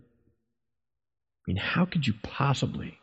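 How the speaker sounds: hushed, aghast whisper; a stunned whisper to the congregation. Real, not acted. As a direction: drop to a whisper in disbelief.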